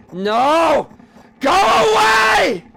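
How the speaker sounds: very high, drawn-out loud scream; shrieked, frantic refusal; desperate scream. Acted. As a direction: scream it out at full force, stretching the words. Climax, screaming them away.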